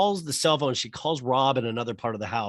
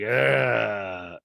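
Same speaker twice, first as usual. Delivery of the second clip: stoned grunt; 4 dB louder than usual. Retold or imitated. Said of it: "he's stoned".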